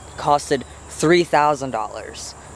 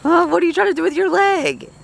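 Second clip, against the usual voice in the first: pet talk; 14 semitones higher than usual. Real, not acted. Owner teasing the cat in high baby talk.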